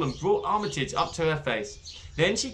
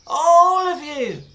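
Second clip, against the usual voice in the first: high, slow, loud calling shout; 12 semitones higher, 9 dB louder, much slower than usual. Acted. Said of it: "shouted Zoe up at them".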